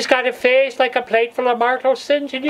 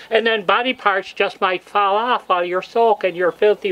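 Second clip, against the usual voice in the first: ordinary delivery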